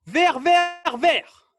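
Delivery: loud, high repeated call; called-out command. Real, not acted. Coach sends players to one cone, loud high call. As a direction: shout the colour three times as an order. Coach calling out colours to run.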